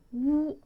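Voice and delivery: Wu held level and high; flat first-tone Wu. Shown as a demonstration. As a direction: sing Wu on one flat pitch. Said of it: "is flat".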